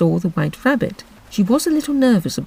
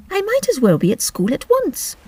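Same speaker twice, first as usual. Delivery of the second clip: Alice voice; 13 semitones higher than usual. Acted. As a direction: speak like a polite little girl.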